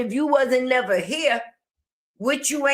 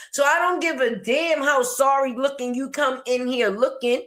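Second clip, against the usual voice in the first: usual speaking voice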